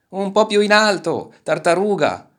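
high, loud group-of-animals cry; shouted warning. A narrator's reading. Animals yelling to the turtle, high urgent shout. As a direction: shout it up to the flier. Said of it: "gridarono gli animali".

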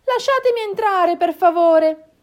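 high, loud little voice; lost little one begging, high-pitched; pleading fox cub. Acted. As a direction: plead to be let inside. Lost fox cub begs to be let in.

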